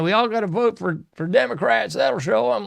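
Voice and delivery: mock him a little; mocking Fuentes. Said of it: "Fuentes is saying".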